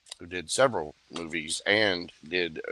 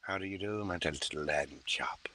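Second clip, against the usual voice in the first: softer, breathed creepy whisper; Hannibal whisper; 2 semitones lower, 10 dB quieter than usual. Acted. To Evelyn, calling her lamb chop in a creepy whisper. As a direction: whisper it with menacing sweetness. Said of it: "Hannibal Lecter".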